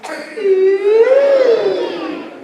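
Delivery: high, loud drawn-out ooh; a high, amazed ooh of discovery; wondering ooh. Shown as a demonstration. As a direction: ooh like you've just seen something amazing. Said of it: "you go".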